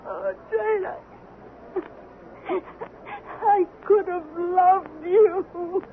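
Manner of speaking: slow, broken, tearful lament; weeping. Acted. Wife weeps over her dying husband, slow tearful lament. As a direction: grieve over him through tears.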